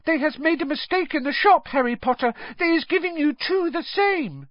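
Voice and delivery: high, squeaky earnest elf voice; squeaky house-elf. A narrator's reading. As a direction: earnestly point out the mistake, squeaky. House-elf Dobby speaking.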